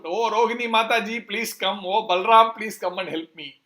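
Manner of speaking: acted cry for help, loud on the names; calling out for help. Bound Krishna calls Rohini and Balram, calling out for help. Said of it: "Then he calls out".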